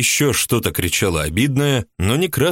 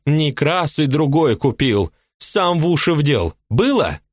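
a much higher woman's voice, resentful and indignant; jealous housewife; 7 semitones higher than usual. A narrator's reading. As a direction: play the jealous mistress complaining. Ulyba accusing, voiced high.

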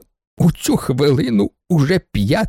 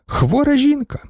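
ordinary delivery, nothing marked

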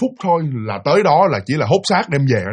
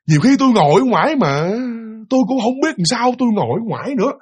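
higher-pitched, hesitant confession; sợ, bối rối; 6 semitones higher than usual. Retold or imitated. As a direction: imitate a bewildered, uneasy man explaining himself. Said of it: "bắt đầu ổng cũng rất là sợ". (giọng bối rối, lo sợ, cao hơn)

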